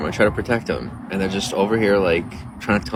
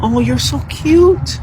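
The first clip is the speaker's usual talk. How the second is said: very high, slow, louder coo to a pet; 6 dB louder, much slower than usual. Real, not acted. Cooing at the wet kitty on stream, very high baby talk. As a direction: stretch it out sweetly like talking to a kitten.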